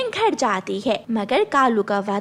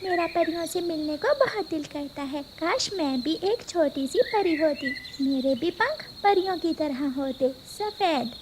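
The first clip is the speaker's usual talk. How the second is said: put-on little girl; 6 dB quieter than usual. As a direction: ask like a curious small girl at bedtime. Little girl dreaming of fairies. (छोटी बच्ची की मासूम आवाज़)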